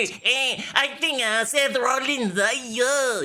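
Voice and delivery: high, slow goofy fan caricature; mock wrestling-fan voice. Impersonating the internet wrestling community, high goofy mockery. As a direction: drag it out high like a dopey fan. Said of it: "your impression of the I W C".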